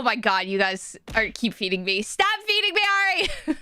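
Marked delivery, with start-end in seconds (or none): 2.0-3.6 high, playful plea to stop; flustered protest. Real, not acted. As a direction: protest in overwhelmed delight. Playful protest at more gifts.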